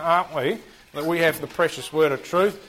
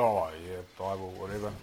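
low, soft, couldn't-care-less mumble; bored indifference; 8 semitones lower, 8 dB quieter than usual. Retold or imitated. Teacher mimicking apathetic people, low bored mumble. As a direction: shrug it off like nobody cares. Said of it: "Enthusiastic or just go".

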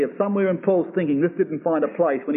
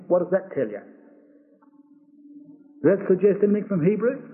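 usual speaking voice

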